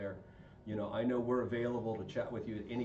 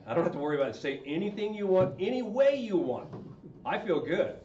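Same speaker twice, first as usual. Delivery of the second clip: loud, high carefree relief; a hammed-up sigh of freedom; mock relief; 7 semitones higher, 7 dB louder than usual. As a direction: act out the relief of being off the hook. Mock relief after wife just vents.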